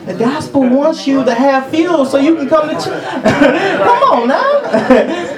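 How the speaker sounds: high, exuberant laughing exhortation. Real, not acted. Preacher teasing congregation about fuel money, laughing. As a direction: break into laughter while preaching.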